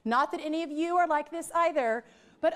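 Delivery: usual speaking voice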